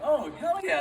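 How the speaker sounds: high-pitched, mock-eager yes; eager reply. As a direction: jump at the offer, pitched well up. Acted eager reply to taco offer.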